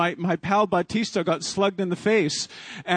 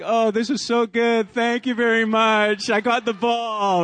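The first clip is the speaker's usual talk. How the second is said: gloating; 5 semitones higher than usual. Retold or imitated. Gloating to booing Texas fans.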